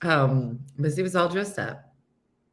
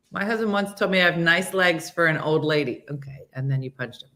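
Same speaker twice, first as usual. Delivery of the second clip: usual speaking voice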